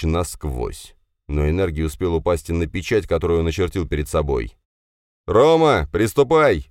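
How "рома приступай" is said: loud, high command; shout. Acted. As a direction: bark the order across the field. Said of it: "крикнул Алексей". (Алексей громко кричит Роме приказ)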